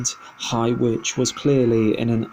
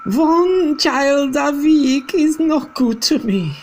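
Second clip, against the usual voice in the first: high, loud scornful witch voice; witch cry; 6 dB louder, slower than usual. Acted. Grand High Witch mocking her witches' efforts, loud and high. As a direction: sneer it at them. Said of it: "the Grand High Witch cried out".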